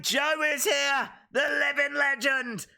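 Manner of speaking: high, drawn-out hype announcement; hyped announcer shout. Acted. Welcoming Joe to the stream, hyped announcer call.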